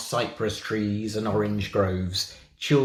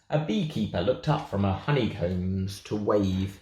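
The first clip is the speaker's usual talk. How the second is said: ordinary delivery, nothing marked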